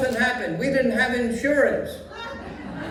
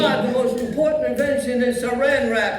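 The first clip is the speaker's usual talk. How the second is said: put-on old Yiddish grandpa accent; Yiddish old man voice.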